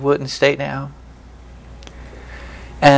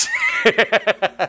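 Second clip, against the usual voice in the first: laughter; 6 semitones higher than usual. Real, not acted. Laughing after the punchline.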